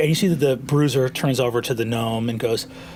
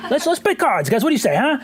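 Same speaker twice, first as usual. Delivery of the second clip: high-pitched, eager gnome; high, chatty little merchant; Pimley; 8 semitones higher than usual. Acted. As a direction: urge the table on in a high gnome voice.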